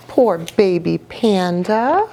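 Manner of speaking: plain reading voice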